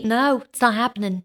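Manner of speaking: ordinary talk, nothing marked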